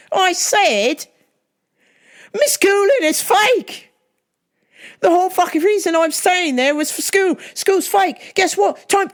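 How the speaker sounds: high-pitched, indignant fake English accent; phony English accent. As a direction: rant in a hammy English accent to be heard. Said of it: "maybe if I use an English accent".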